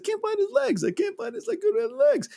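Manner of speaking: high-pitched mock breakdown, words tumbling in repeats; imitating tearful breakdown. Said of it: "And he breaks down. He's like".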